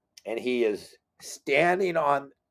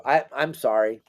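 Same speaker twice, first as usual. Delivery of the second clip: ordinary delivery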